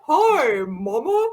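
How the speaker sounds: put-on toddler with a macho cartoon voice; Johnny Bravo. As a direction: greet Mom like a tiny cartoon hunk. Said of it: "he sounded like frickin' Johnny Bravo".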